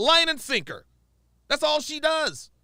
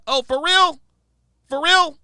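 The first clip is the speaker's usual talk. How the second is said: loud sarcastic disbelief; 5 semitones higher, 8 dB louder than usual. Real, not acted. Mocking a doubter's complaint.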